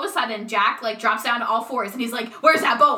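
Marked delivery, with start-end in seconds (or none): 2.4-3.0 loud barked question, hammed up; mock dog-man shout. To the maids, a hammed-up doggy shout. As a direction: yell it like a man turned dog.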